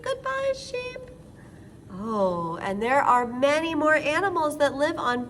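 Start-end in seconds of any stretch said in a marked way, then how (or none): 0.0-1.1 sweet goodbye. Acted. Waving bye to toy sheep.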